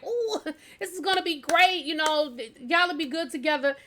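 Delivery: high-pitched giddy gushing with a laugh. Retold or imitated. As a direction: imitate an excited, oblivious matchmaker. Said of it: "he is so giddy".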